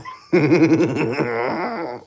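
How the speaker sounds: high shrieking laughter. Real, not acted. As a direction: squeal with excited laughter. Excited shrieking over discovery.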